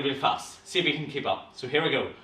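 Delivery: normal talking voice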